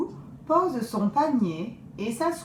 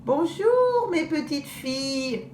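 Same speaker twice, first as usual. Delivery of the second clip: higher, sweet fake granny; wolf in grandmother's voice; 7 semitones higher than usual. Wolf greeting the girls in grandmother's voice, fake sweet. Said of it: "dit-il de sa voix de grand-mère You".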